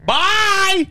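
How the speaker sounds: a shouted, squealing farewell; host shouting goodbye to listeners at the end of the show; loud high goodbye. Real, not acted.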